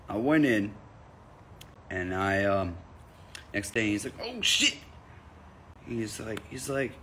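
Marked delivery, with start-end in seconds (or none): 4.2-4.8 high-pitched mock outburst of delight; excited recognition. Barista seeing the comedian return next day, excitedly. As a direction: act out an excited greeting, pitched way up.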